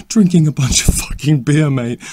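barely holding back laughter; laughing through the complaint. Real, not acted. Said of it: "Sorry, I'm trying not to laugh".

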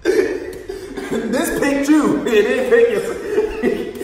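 laughing through the words; cracking up while teasing. Real, not acted.

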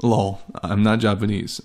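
usual speaking voice

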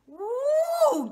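high, loud whooping cry; shocked woo. Real, not acted. Reacting to the episode, high loud whoop. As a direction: let out a stunned whoop. Exclaims in disbelief.

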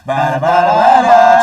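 slow sung fanfare. Pretend. Fanfare for gift reveal.

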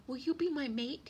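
high, eager sparrow voice; little bird's high, hopeful question; hopeful rush. A narrator's reading. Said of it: "all in a rush".

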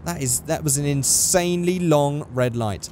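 normal talking voice

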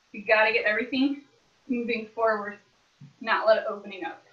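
ordinary delivery

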